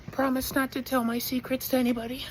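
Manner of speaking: a hint of a secretive old neighbor; old lady neighbor.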